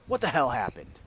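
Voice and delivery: lightly voiced captain, first demand; indignant captain. Captain questions the drinkers at the inn, mildly indignant. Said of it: "the captain walks in and goes".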